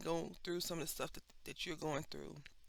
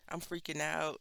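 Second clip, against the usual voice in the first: normal talking voice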